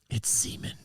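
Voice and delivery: whispered crude guess; voiceless, conspiratorial whisper; hushed aside. Real, not acted. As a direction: slip it in under your breath, whispering. Blurts crude guess about the food.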